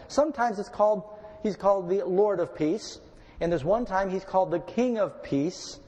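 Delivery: normal talking voice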